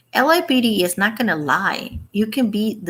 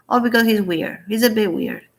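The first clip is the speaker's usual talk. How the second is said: ordinary talk, nothing marked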